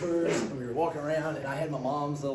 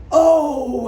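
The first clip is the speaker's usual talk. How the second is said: high, loud delighted "Oh"; delighted surprise; 11 semitones higher, 15 dB louder than usual. Retold or imitated. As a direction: burst out in relieved joy, pitched way up.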